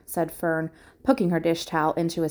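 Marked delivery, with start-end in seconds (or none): none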